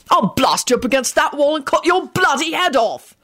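high-pitched, vehement threat; angry threat. A narrator's reading. George threatening to blast Quentin, angry. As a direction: threaten him with relish.

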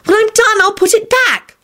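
put-on very high, upset girl; high-pitched, hurt pleading; tearful protest. A narrator's reading. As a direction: protest defensively, close to tears. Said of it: "horrified when tears sprang up".

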